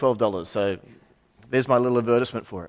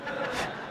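soft laugh; 12 dB quieter than usual. Real, not acted. Laughs at own Canadian remark.

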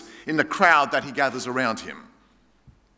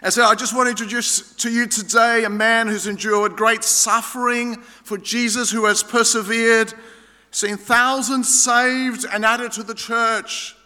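lightly voiced grand stage host, higher and building; emcee introduction; 6 semitones higher than usual. Emcee hyping a guest speaker to the crowd, a light grand host voice. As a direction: talk the guest up like a hyped-up MC. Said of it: "and this Aussie guy said".

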